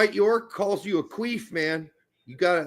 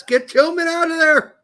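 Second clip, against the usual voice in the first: hammed-up shouted chat comment, higher and louder; mock yell; 10 semitones higher, 8 dB louder than usual. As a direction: bellow it like a furious fan.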